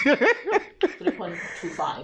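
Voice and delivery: slow, laughing repeat of the mark; amused disbelief, slowly laughed out. Real, not acted. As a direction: laugh through the number, drawn out. Laughing while repeating her mark.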